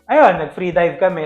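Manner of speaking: usual speaking voice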